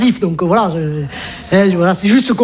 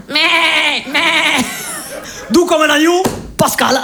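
pitched up, pleading like a lamb; high whiny plea; 9 semitones higher, much slower than usual. Acted. A lonely man pleading with a woman, in a high whiny voice. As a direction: bleat it out like a meek little lamb.

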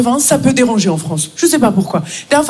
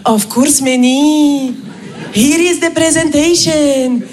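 put-on cheerful Indian-accented eagerness, drawn out; Raj; slower than usual. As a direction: sing out an obliging reply. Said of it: "Raj me répond dans les trente secondes".

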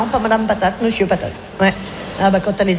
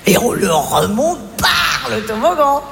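slow words through laughter; laughing, drawn out; 3 semitones higher, much slower than usual. Real, not acted. Telling friend the slide prank, slow and laughing. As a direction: relish the prank plan.

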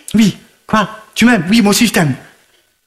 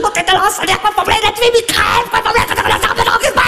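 high breathy ranting shout; 11 semitones higher than usual. Real, not acted.

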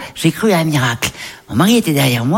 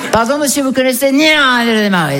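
calling to a stranger; 8 semitones higher than usual. Retold or imitated. Lost driver asking a man the way, high calling voice. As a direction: hail a passer-by in a raised voice.

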